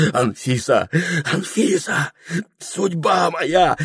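rising, high ecstatic calls; rapturous cry. A narrator's reading. Прохор rushing to kiss Анфиса, rapturous cry. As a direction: cry out in sweet abandon. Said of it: "с сладостным криком бросился".

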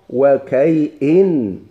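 "وكيئن" slow and loud, wa-kay-'in; "وكيئن" stretched syllable by syllable; variant pronunciation. Shown as a demonstration. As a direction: separate "وكيئن" into kay and a hamza -in. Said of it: "كاين لغة".

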